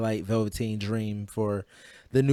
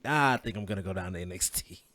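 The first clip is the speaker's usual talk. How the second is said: low, breathy witchy impression; Finn Balor impression; 4 semitones lower, 5 dB quieter, faster than usual. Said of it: "you sound like a witch".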